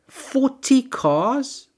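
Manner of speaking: high, drawn-out disbelief; stunned, slow questioning; incredulous. Real, not acted. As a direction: stretch it out, can't believe it. Incredulous at forty cars.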